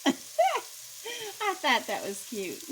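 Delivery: words squeezed out through real laughter; laughing. Real, not acted. Cook laughing at her own joke, giggling through it. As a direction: laugh through the line, high and slow.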